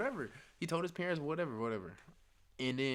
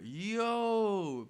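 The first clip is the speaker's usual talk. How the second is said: high, loud, drawn-out mock alarm; alarmed exclamation; 8 semitones higher, 7 dB louder than usual. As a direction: call out in exaggerated disbelief. Acts out alarmed reaction to friend.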